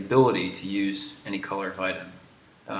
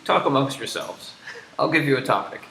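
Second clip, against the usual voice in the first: raised, punchy mock talk-show host; Mike Myers impression; 5 semitones higher, 6 dB louder than usual. Host handing players a topic, Mike Myers impression. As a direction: hand over a topic like a sketch host, higher and louder. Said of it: "you don't know the Mike Myers".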